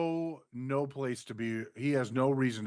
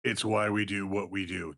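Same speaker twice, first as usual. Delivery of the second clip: hint of a wrestling executive, pitched lower; Triple H; 5 semitones lower than usual. Co-host predicting the boss's excuse, lightly imitated low voice. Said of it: "That's what Triple H is gonna say".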